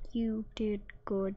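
soft unvoiced whisper; whispered NPC line. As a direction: breathe the praise. Voicing the game NPC quietly.

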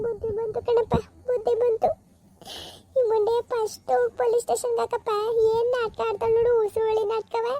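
put-on remorseful old aunt. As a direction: wail out your regret, then curse her. Plays weeping old aunt. (ಪಶ್ಚಾತ್ತಾಪದ ಅಳುವ ಚಿಕ್ಕಮ್ಮ)

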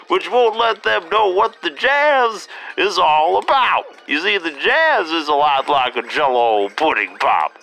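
put-on high, slow old man rambling; doddering grandpa lecturing; old jazzman voice. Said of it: "now that I got that out of my system".

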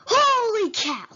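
high, loud burst of shock; startled exclamation. Real, not acted. Player startled by the game, loud surprise. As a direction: exclaim it big, falling from high.

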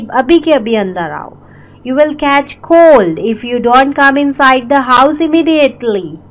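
ordinary talk

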